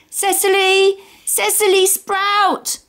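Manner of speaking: put-on high, drawn-out child's call. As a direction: call across the campsite. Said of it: "Tomato calling out".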